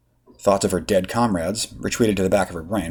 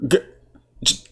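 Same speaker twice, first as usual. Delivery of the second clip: sputtering; 4 semitones higher than usual. Acted. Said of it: "she sputtered".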